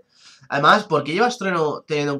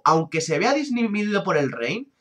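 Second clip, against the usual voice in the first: usual speaking voice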